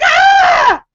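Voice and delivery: very high, loud scream; retold scream. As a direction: let out a piercing startled shriek. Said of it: "and I scream".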